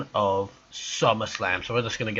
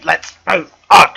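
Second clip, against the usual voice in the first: loud, punchy call to move on; shouted sign-off; 3 semitones higher, 11 dB louder than usual. Real, not acted. Reviewer closing the match verdict to move on, shouted. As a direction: shout it to close the topic. Abruptly calls time on the match.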